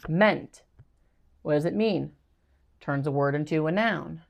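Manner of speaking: usual speaking voice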